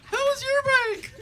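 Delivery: very high, loud, slow astonished question; hammed-up shocked small talk; permanently surprised. Greeting everybody after eyebrow surgery, comically surprised. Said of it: "I look fucking surprised all the time".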